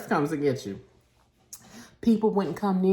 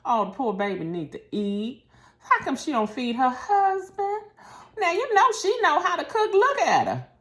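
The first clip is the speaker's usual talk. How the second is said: mock-pitying gossip about the wife; gossiping onlooker; 10 semitones higher than usual. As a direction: cluck like a judging church lady. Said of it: "I mean poor as in".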